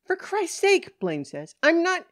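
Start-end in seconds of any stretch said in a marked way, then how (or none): 0.0-1.0 loud, high cry of exasperation; exasperated outburst. Acted. Woman fed up with patronizing policeman, exasperated outburst. 1.6-2.0 loud, high, indignant blurt; indignant protest. Acted. Woman starting to object to policeman, indignant protest.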